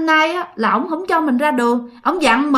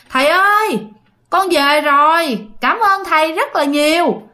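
calling out; 5 semitones higher than usual. Acted. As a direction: call out thanks to a revered master, pitched up. Chồng gọi cọp từ xa. (gọi vọng lên, giọng cao)

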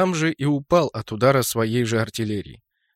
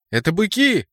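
higher-pitched warning call; alarmed cry; 6 semitones higher than usual. A narrator's reading. Fighter spots the enemy heavies, alarmed cry. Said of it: "крикнула Мираж".

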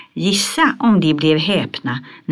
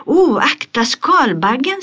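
higher, urgent call, a touch louder; shout of warning; 9 semitones higher, 4 dB louder than usual. Acted. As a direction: warn him off the beetle with a quick cry. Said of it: "skrek Pippi". (Pippi varnar Tommy med ett högt rop)

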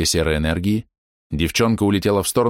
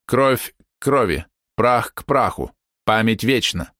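ominous incantation; 3 semitones higher, slower than usual. Acted. Old woman casts a spell.

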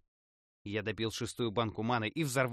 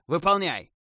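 command; 6 semitones higher, 9 dB louder than usual. A narrator's reading. Commander orders the raid.

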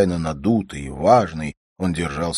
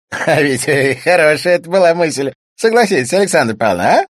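loud, high character voice breaking from a laugh; laugh, then crow it loudly; pitched-up, full-voiced amusement; amused, laughing; 11 semitones higher, 10 dB louder than usual. A narrator's reading. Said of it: "Пегасов засмеялся".

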